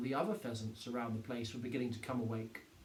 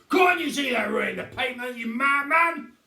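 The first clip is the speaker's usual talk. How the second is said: higher and louder angry rant; furious shout; 9 semitones higher, 12 dB louder than usual. A narrator's reading. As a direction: yell at the madman. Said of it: "Mister Hazel bellowed".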